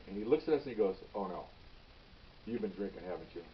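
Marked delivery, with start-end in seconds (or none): 1.1-3.4 low, very soft knowing question; hushed dismay. Retold or imitated. Supervisor realizing his officers have been drinking, hushed dismay. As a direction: drop right down, quietly catching them out.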